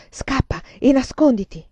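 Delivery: plain reading voice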